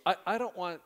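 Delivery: ordinary delivery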